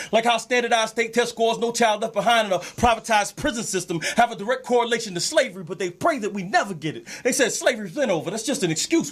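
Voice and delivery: rhythmic, rhyming slam delivery; spoken-word poem. Shown as a demonstration. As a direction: drive the verses along in a steady flow. Performs own poem.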